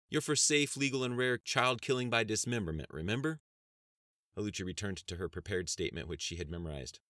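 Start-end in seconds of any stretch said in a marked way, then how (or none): 0.1-3.4 sharp accusing. Acted. Accusing opponent in debate.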